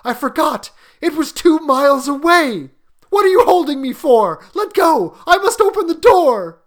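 high, loud, desperate pleading; a mother struggling to break free; frantic cry. A narrator's reading. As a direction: build to a high-pitched shout. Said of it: "she cried, struggling mechanically".

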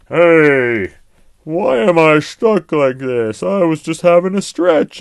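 a hint of a stuck, puzzled lion calling out; old lion voice. A narrator's reading. Old lion stuck in the tree, calling out loudly. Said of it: "said the old lion".

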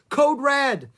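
high, loud emergency call; alarmed cry. A narrator's reading. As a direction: sound the alarm loudly. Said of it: "cried Annie".

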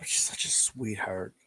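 soft unvoiced aside; a hushed, fond whisper. Real, not acted. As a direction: breathe it like a secret. Tender aside about old dog.

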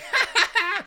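shrill mock cackle; high mocking laugh. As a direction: laugh at him, high and mean. Pranksters laugh at Hubie.

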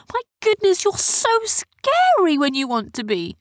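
very high-pitched, gushing relief; relieved exclamation. A narrator's reading. Sophia to her frightening friends, relieved and amazed. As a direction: exclaim in a squealing voice.

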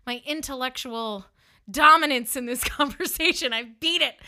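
triumphant gloating. Retold or imitated. Woman who knew she won, gloating. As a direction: gloat over winning the argument. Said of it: "and I knew I won".

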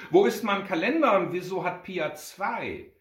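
put-on loud, high boy's complaint; outraged little brother; indignant shouting. A narrator's reading. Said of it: "schrie er".

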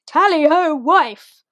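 imitated hearty hail to the wife; mock hearty call. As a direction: call out a jolly greeting, hammed up. Quoting Mister Bennet's greeting from sofa.

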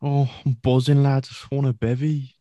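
high, loud Scouse "Oh, I'm buzzing, lad"; Scouse impression. Mate mimics the fighter's post-fight Scouse interview, high and loud. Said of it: "this being like".

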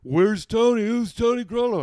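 high, loud mock shouting; shrill crowd shouting his name; kids yelling. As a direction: yell across the field for a missing player. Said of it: "The other team's kids are yelling".

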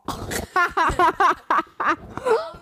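startled laugh. Real, not acted. Reacting to spicy taste.

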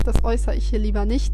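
usual speaking voice

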